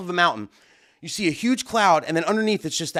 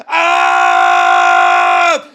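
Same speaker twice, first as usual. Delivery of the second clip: sudden high panicked yelp, very loud; panicked shout; 14 semitones higher, 10 dB louder than usual. Real, not acted. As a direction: cry out as the rain hits the gear. Downpour hits his gear.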